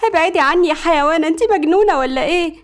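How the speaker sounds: high-pitched distressed protest; mild screaming. A narrator's reading. Girl being pulled by the hair, shrieking mildly. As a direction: cry out to get her off. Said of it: "سمر فضلت تصوت".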